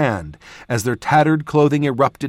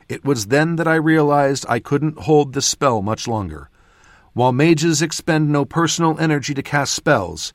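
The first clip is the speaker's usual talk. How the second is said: ordinary delivery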